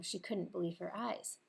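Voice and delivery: usual speaking voice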